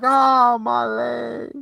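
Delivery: high, strained cry of pain over a broken leg; a hammed-up howl of hurt; mock pain cry. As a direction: yelp like the leg just snapped. Victim cries out in pain.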